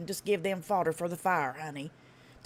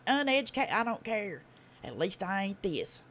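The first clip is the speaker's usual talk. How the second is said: ordinary delivery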